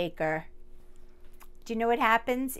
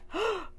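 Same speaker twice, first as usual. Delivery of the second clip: sharp gasp; 10 semitones higher than usual. Acted. Sneeze build-up in rhyme.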